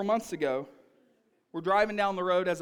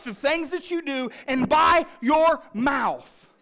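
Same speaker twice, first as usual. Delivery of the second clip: raised, higher-pitched sermon delivery; loud, emphatic preacher's warning; forceful preaching; 6 semitones higher, 9 dB louder than usual. Real, not acted. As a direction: drive each point home to the congregation.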